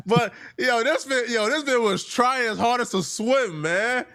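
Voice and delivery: laughing hard while talking, pitch way up; cracking up through every word. Real, not acted. Reaction to a squirming interview.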